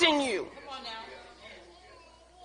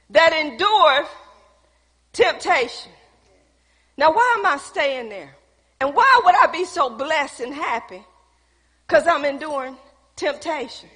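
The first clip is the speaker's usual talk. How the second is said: ordinary talk, nothing marked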